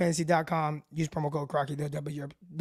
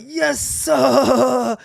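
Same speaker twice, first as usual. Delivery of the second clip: mock Cajun accent, higher and louder; imitating a Cajun guy; 5 semitones higher, 7 dB louder than usual. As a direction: throw on a hammed-up Cajun drawl for the greeting. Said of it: "he's, like, super Cajun".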